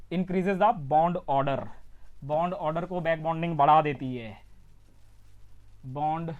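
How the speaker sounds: spaced-out phrases with long pauses; dictating. Real, not acted. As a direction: dictate it piece by piece for note-taking. Teacher dictating the statement for notes. (ठहर-ठहर कर लिखवाते हुए)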